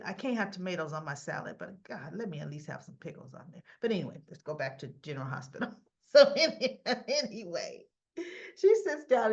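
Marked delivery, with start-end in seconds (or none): 6.1-9.3 chuckling through a return to the topic; words broken up by a real chuckle; laughing. Real, not acted. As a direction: laugh it off and try to move on. Laughing while returning to the recap.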